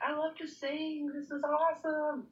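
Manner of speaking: mildly gushing, high and drawn out; gushing quote. Retold or imitated. Singer quoting own young self on loving music, gushing. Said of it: "just naturally was like".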